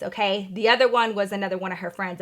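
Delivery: usual speaking voice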